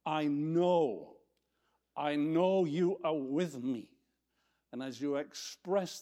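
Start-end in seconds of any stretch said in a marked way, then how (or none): 0.1-3.9 drawn-out, louder declaration of faith; slow and emphatic, stretching the words; declaring to oneself. Acted. Believer telling himself in the dark valley, emphatic declaration. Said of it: "you say to yourself".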